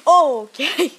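high, loud startled okay; jolted by a gust; startled yelp. Real, not acted. Startled by wind outside.

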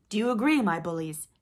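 loud pirate captain rallying the crew; rallying call. A narrator's reading. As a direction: call on your men for agreement. Hook rallies his pirates.

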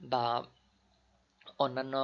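ordinary delivery

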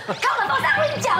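high, fast mock martial-arts call; kung fu battle cry. Playing Wong Fei-hung with the girls, mock battle cry. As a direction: call out the move like an action hero. Acting out kung fu move with friends.

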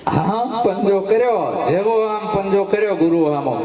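dramatic climax. A narrator's reading. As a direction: build the climax as the lion raises its paw. (પંજો ઉગામતી ક્ષણનું ઉત્સાહભર્યું વર્ણન)

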